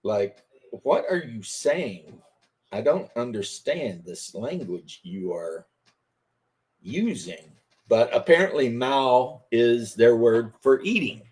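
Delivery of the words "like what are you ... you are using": baffled and quiet. Retold or imitated. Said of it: "And I'd always be like".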